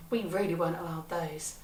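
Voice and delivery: ordinary delivery, nothing marked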